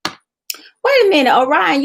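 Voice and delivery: high, loud and drawn-out startled exclamation; surprised wait. Real, not acted. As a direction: stop short as if caught off guard. Catches a viewer's comment.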